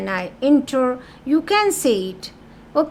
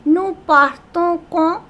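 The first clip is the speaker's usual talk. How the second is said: "nous partons quand" pitched up as a spoken question; slow, higher-pitched questioning tune on "nous partons quand"; intonation question; 5 semitones higher, 3 dB louder than usual. Shown as a demonstration. Teacher models familiar spoken French for students, intonation question on "nous partons quand". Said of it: "familiar intonation form of questioning".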